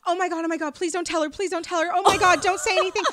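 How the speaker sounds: high, loud frantic begging; mock panicked pleading on the phone. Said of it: "his voice changed".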